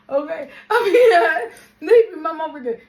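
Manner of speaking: high, loud laughing through words; can barely talk for laughing. Real, not acted. Laughing hard while telling story.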